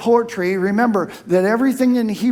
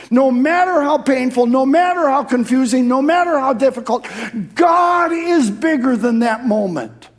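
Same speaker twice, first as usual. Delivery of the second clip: full-force preaching; 6 semitones higher, 5 dB louder than usual. Acted. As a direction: build each phrase like a revival peak.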